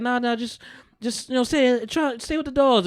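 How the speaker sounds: high coaxing voice; high, pleading sweet talk; mock pleading. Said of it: "She's like".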